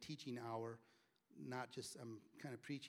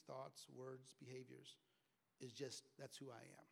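soft, earnest prayer; 5 dB quieter than usual. Acted. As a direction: pray it quietly to the Lord. Leads a prayer.

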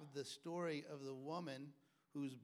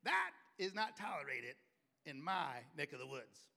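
stern dad again; 6 dB louder than usual. Retold or imitated. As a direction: lay down the law again. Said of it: "He said".

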